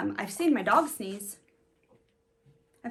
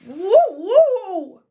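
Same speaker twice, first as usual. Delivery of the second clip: loud higher woo woo bark; dog bark; 8 semitones higher, 14 dB louder than usual. Acted. The daunted dog barks, loud barking. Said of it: "who barked".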